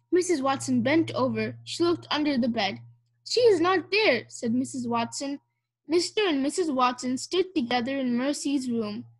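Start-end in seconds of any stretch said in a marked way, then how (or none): 3.2-4.3 high, worried voice; alarmed report. A narrator's reading. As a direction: confirm the worry to a husband. Wife alarmed, pig still missing.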